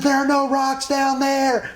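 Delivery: high, loud, slow shouting; loud retold call. Teacher shouting over waterfall roar, high slow call. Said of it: "He said".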